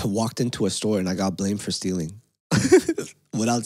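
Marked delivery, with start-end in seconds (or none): 2.5-3.1 a sudden high, loud laugh; real laugh; high burst of laughter. Real, not acted. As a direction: laugh out at the absurdity. Laughing at getting blamed.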